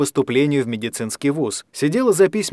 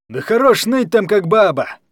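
higher, loud jeering yell; mocking shout; 7 semitones higher, 4 dB louder than usual. A narrator's reading. Guardian jeering at Kostya from behind Anya, loud and higher. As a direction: sneer at the crying boy. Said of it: "крикнул Захар".

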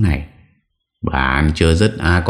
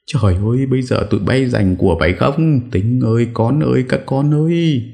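wailing lament; 9 semitones higher than usual. A narrator's reading. Mother calling out to sons fighting over property, wailing. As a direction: wail higher and higher as you call your children.

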